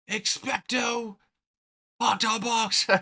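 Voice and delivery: high, drawn-out wizard incantation; spell-casting voice. Acted. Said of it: "where he, like, casts a spell".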